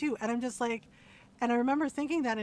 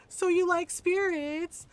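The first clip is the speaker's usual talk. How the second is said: high, teasing, badgering voice, climbing louder; mocking nosy questioner; 7 semitones higher, 7 dB louder than usual. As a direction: pester him with a silly sing-song question. Mocking Sarah's pestering question.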